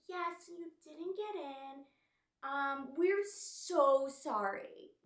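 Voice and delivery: high, stretched-out fake apology; mock apologetic staffer. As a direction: draw out the insincere sorry. Imitating check-in person's hollow apology.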